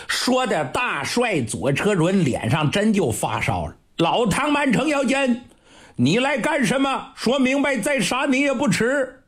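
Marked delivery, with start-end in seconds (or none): 4.0-9.2 higher, stern commanding question; stern demand. Acted. Embarrassed marshal sternly demands the old general's purpose, high. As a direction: press him hard, barely holding anger.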